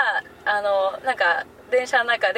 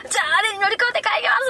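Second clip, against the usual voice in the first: fast, high, eager announcing; chirpy call-out; excited; 9 semitones higher, faster than usual. Retold or imitated. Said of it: "ってなって".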